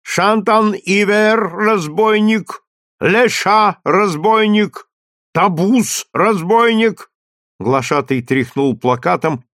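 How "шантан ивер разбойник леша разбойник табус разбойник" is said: slow, loud and higher, like a trumpet; booming crier. A narrator's reading. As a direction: boom each word out like a trumpet. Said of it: "Глашатый продолжал".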